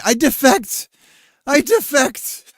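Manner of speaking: high, loud, wailing "I defect!" as if in tears; hammed-up tearful plea, stretching the second one slowly; mock crying defector. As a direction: wail it like a sobbing defector. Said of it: "start crying and say".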